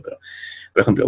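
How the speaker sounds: normal talking voice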